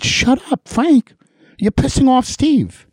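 high-pitched mock scolding; retold agitated warning. Host voicing friends warning him off, agitated.

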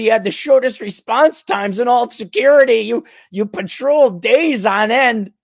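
berating himself for getting lazy; scornful inner critic. Acted. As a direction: scold yourself like a fallen hero's harsh conscience. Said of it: "There's that voice".

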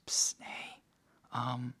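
imitating a hushed bedside whisper; very soft, whispered nudge to wake someone; retold whisper. Wife waking her husband about the contractions, whispering.

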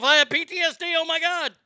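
hammed-up panicked claim; mock panic. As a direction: act startled by the phone. Mocking reaction to phone ringing.

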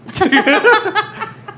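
high burst of laughter. Real, not acted. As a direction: laugh out loud at yourself. Laughing at own mistake.

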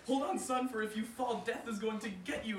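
high, loud worried urge; urging. Acted. Anansi urging his son, worried. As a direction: hang on!. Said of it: "Anansi said to his child".

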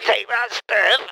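triumphant. Pretend. Avenger gloating as he keys Steph's car. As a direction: savour the revenge as you say it.

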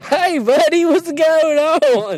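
high, loud, slow goofy greeting; mock stranger. Friend mimics the stranger in the game, high loud goofy greeting. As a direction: stretch out a dopey friendly hello.

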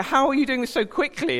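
mock astonishment at the friends; amazed child.

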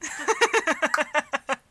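maniacal evil laugh; villain laugh. Acted. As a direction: cackle like a cartoon villain. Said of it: "my maniacal mwahaha".

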